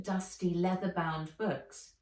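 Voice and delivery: usual speaking voice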